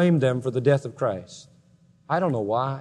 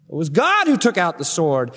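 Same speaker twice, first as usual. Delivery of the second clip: ordinary talk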